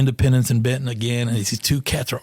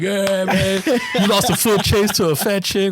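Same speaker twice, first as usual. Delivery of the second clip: higher, teasing congratulations; mock cheering; 6 semitones higher, slower than usual. Colleagues greeting officer after the lost chase, mock cheering. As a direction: imitate colleagues jeering with applause. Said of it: "people are waiting for me, like, clapping".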